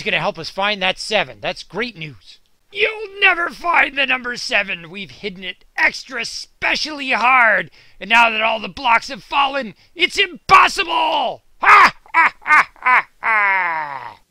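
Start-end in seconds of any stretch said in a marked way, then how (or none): 2.7-11.4 villain taunt. Acted. Ninja villains taunting again. 11.6-14.2 villain laugh. Acted. Villain laughs after taunt.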